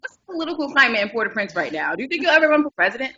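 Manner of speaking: rapid-fire questions. Retold or imitated. Young reporter grills [name], rapid-fire and eager. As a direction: fire questions without a breath.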